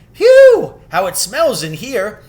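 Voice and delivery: very high, loud phew of disgust; disgusted exclaim. A narrator's reading. Someone in the dungeon reeling at the smell, loud. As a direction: recoil from the stink. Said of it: "everyone's voices crying out at once".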